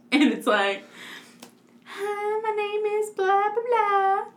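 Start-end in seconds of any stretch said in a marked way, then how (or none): 1.8-4.2 high, sing-song mock voice; put-on note-reading voice. Mocking the note left on the car, high put-on voice. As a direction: read it out in a silly high monotone.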